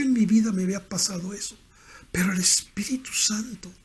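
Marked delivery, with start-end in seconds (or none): 2.1-3.7 hushed and reverent, almost voiceless; awed whisper. Real, not acted. Preacher to congregation about the Spirit, in a reverent whisper. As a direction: breathe Espíritu Santo in a hush.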